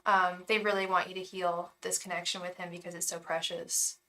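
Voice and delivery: normal talking voice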